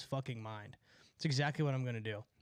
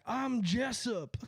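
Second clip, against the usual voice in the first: slow, higher mocking voice; mocking Jessup; 6 semitones higher, much slower than usual. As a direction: drawl out the name to make fun of him. Mocking the angry driver again.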